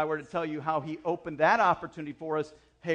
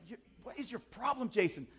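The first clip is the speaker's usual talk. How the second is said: exasperated self-rebuke, pitched up; self-frustrated; 6 semitones higher, 5 dB quieter than usual. Retold or imitated. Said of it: "I was so frustrated with myself".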